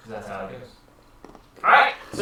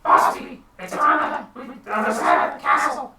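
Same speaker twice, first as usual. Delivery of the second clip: high, halting minion voice; faltering, squeaky henchman making excuses; nervous underling; 7 semitones higher, 3 dB louder than usual. Acted. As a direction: stammer excuses to the boss in a pitched-up voice. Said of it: "the one guy standing goes".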